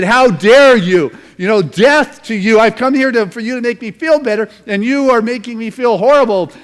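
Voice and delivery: high, very loud royal outrage; indignant outburst. Acted. Insulted wart prince threatening the wise man, loud outraged outburst.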